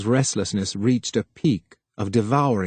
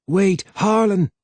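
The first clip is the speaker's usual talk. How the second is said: calling after; 8 semitones higher than usual. A narrator's reading. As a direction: call him back as he runs off. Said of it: "called after the younger man".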